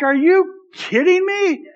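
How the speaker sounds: put-on high, slow sarcastic kid's whine. Mimicking a six-year-old's sarcastic tone to parents. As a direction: drag it out like a mouthy six-year-old. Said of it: "his tone".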